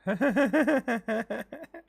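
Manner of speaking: loud, high burst of laughter; real laugh that breaks out; cracking up at the chef's outrage. Real, not acted. Player laughs at the scene.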